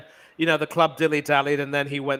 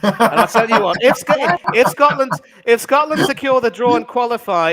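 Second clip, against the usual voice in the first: louder, higher pundit call; excited commentary; 6 semitones higher, 6 dB louder than usual. Acted. Mock pundit hyping Scotland's chances, raised commentary. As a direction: build it up like live commentary.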